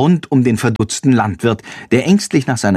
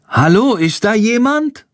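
cautious call; 11 semitones higher than usual. A narrator's reading. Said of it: "ruft Jendrik vorsichtig".

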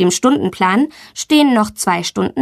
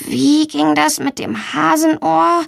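slow, higher-pitched puzzling; child trying to remember; pondering; 7 semitones higher than usual. A narrator's reading. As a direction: wonder to yourself, slowly. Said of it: "überlegt sie".

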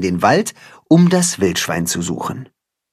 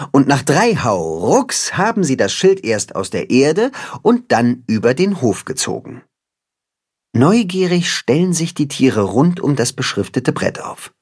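plain reading voice

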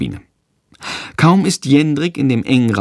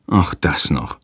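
low, weary mild groan; 6 semitones lower, 3 dB quieter than usual. A narrator's reading. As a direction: sigh it out, put upon. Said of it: "stöhnt Hans". (stöhnend, etwas tiefer)

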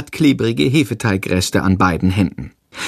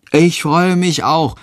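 lightly voiced boy; 6 semitones higher, slower than usual. A narrator's reading. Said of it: "stimmt Tom zu".

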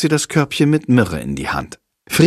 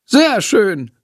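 high, gladly approving teacher; pleased; 10 semitones higher than usual. A narrator's reading. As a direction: praise them with pitch lifted well up. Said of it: "freut sich Frau Schmidt". (erfreut, mit hoher Stimme)

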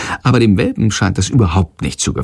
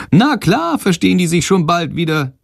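high chirpy bat, much higher; little bat cheerfully sure, pitched way up; 8 semitones higher than usual. Acted. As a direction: reassure him brightly as the bat. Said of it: "sagt Shandor".

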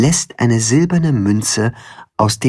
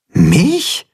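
a high startled little question; baffled squeak of disbelief; perplexed; 10 semitones higher, 3 dB louder than usual. A narrator's reading. Chicken stunned to hear about his own egg. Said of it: "fragt Timme perplex".

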